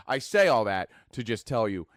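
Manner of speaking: normal talking voice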